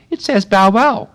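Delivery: high, loud little flower cry; a tiny flower shouting up; shrill daisy cry. Acted. A daisy cries out to Alice, high and loud. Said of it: "cried a daisy".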